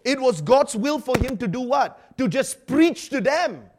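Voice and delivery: high, loud, driving preacher voice; fiery preaching. Real, not acted. Preacher drives the point home loudly to the congregation.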